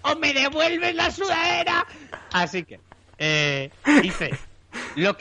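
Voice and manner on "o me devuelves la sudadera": mock shout. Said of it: "Le digo".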